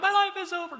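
put-on high, loud melodramatic kid wail; mock the son's teenage despair, high and loud; whiny teen. Dad mocking his son's teen despair, high and loud. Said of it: "they're like".

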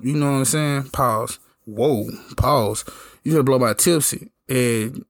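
ordinary delivery

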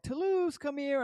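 high-pitched coaxing call to a cat. Retold or imitated. Searcher hoping the lost cat hears him, calling out. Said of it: "I'm calling his name".

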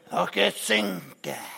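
slow, heavy pronouncement; slow doom. Acted. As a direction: drag it out ominously. (langsomt og tungt)

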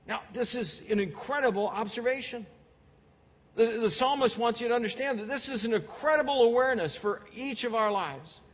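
fervent preacher pressing the point home; preaching at full force. Acted. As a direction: preach with building urgency. Preaching climax.

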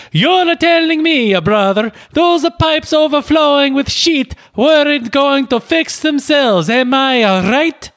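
high, slow hammed-up Italian accent as Luigi; very high cartoon Italian accent. Cast member reading Luigi's line to Mario, Italian accent. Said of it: "he does have an offensive Italian accent".

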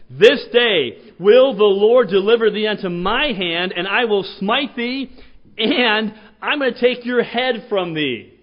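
loud, slow battle cry; defiant shout. Retold or imitated. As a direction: proclaim it like a war cry. David's defiant shout to Goliath.

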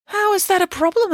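put-on high, indignant girl; offended girl voice. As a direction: bristle with offence. Samantha asks, offended.

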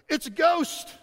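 high, loud panicked cry of fishermen; terrified disciples shouting at a ghostly figure; frightened cry. A narrator's reading. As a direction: cry out as if you've seen a ghost. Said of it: "they cried out in fear".

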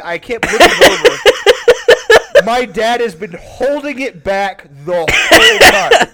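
can barely get the words out for laughing. Real, not acted. Telling friends about his dad, laughing through the words.

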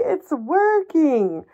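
"working" sung out high and slow, mock triumphant. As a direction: stretch out it's working, high and gleeful.

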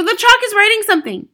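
high-pitched frantic shout; loud hysterical shriek; screaming. A narrator's reading. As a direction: scream the news to the whole room. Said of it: "screamed Nigel".

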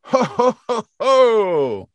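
high, loud hearty ho ho ho; a booming Santa laugh. Retold or imitated. CEO showing the Santa laugh she wants. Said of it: "my Santa needs to go".

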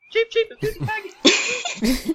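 high chirpy bird voice; cartoon bird voice. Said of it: "the birds wanna chime in".

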